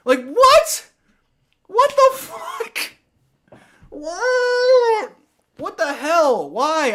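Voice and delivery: high screeched disbelief, words stretched out; incredulous outrage. Real, not acted. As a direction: wail in exasperation at an absurd call. Outraged at taunting call.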